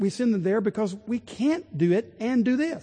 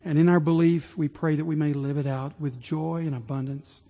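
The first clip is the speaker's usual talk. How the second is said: hushed closing prayer; 5 semitones lower than usual. Real, not acted.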